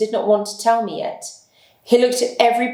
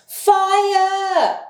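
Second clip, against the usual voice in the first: a long, very high yell; shouted; 12 semitones higher than usual. Acted. The group shouting fire in the passage, a high long yell. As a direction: yell it up high at full stretch. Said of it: "we shouted as loudly as possible".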